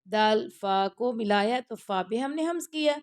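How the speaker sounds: ordinary delivery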